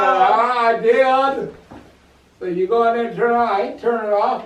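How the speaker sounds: loud, high, insistent retort; acted arguing. Frightened family arguing over the light, loud insistence.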